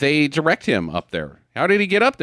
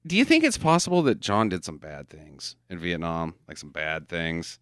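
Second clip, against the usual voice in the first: softer, low-pitched probing question; discreet, lowered-voice asking; hushed question; 9 semitones lower, 6 dB quieter than usual. Acted. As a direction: put it to them quietly and lower. Acting out asking someone about Rambo.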